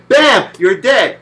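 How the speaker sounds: slow, loud hit on bam; punched out. Acted. Boss kills you in one hit, punched slow and loud. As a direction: slam the bam down.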